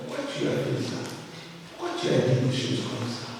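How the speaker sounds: hushed, acted questioning of a brother; quiet reproach. Man asks his brother why he said that, quiet reproach. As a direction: imitate a reproach, very softly. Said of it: "Il dit à son frère".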